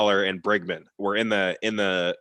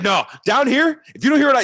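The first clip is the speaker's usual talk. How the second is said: imitating a yelling coach; 7 dB louder than usual. Coach teaching the new player manners, mock yell. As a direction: lay down the rules like a drill coach. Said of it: "yelled at me".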